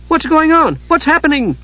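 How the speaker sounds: high, excited little voice; breathless curiosity; eager kender. A narrator's reading. Tas lifting his head, eager and high.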